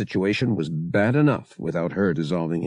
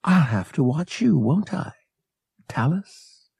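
higher, playfully flirtatious line; 7 semitones higher than usual. A narrator's reading. Bria to Han after a glance, flirtatious. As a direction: tease him flirtatiously, pitched up. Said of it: "She cast a flirtatious glance at Han".

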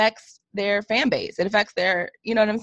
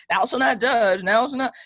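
higher, mock-solemn commandment; joking mockery; 3 semitones higher than usual. Wife confronts him and he jokes it off, mock-solemn. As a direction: imitate someone treating it all as a joke. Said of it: "it was like a joke to him".

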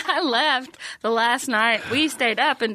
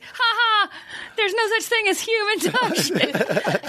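high-pitched put-on nerd voice; mocking nerd; 11 semitones higher, slower than usual. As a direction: imitate a know-it-all, high and laughing. Imitating a nerdy heckler, called nerd.

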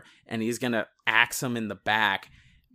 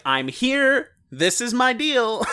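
much higher, bold stage entrance; announcing yourself; 11 semitones higher, 4 dB louder, slower than usual. Shown as a demonstration. Improv actor entering the scene, announcing boldly. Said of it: "you announce, like".

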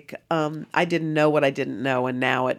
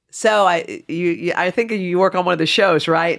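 loud, higher-pitched chatty stranger; nosy seatmate; 4 semitones higher, 10 dB louder than usual. Retold or imitated. Seatmate who spots a TV host, loud and nosy. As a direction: pipe up loudly, eager to place her.

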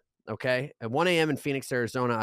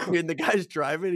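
ordinary talk, nothing marked

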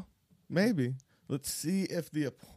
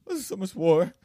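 higher, louder whiny plea; whiny child voice; 7 semitones higher, 11 dB louder than usual. Pretend. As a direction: wail it like a kid. Exaggerated childlike lament.

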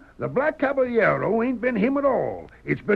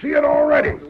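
louder, higher triumphant reveal; 5 semitones higher, 6 dB louder than usual. Acted. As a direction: crow over the proof.

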